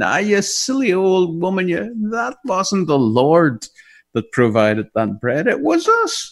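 loud jeering lads; mocking shout. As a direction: shout it in the door, teasing. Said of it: "shouted in".

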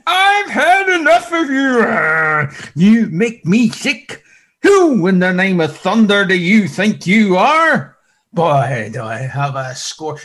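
loud, high, furious scolding; hammed-up angry Lord berating Saul; angry rebuke. Preacher voicing what the Lord did not say, loud and furious. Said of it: "He didn't say".

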